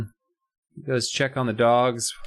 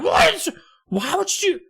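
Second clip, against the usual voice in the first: very high, wounded squawk; mock outraged disbelief; 4 dB louder, slower than usual. Grandpa learns the Jesus sighting was a prank, mock outraged disbelief. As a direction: squawk in cartoon indignation.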